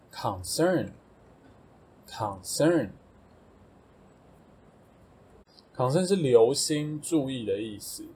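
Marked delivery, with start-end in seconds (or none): 0.1-6.1 concern said three times, slow and drawn out; teacher drills concern slowly for the class; very slow model word. Shown as a demonstration. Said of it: "下一个concern".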